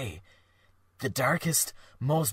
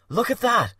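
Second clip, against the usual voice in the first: excited exclamation; 11 semitones higher, 8 dB louder than usual. Acted.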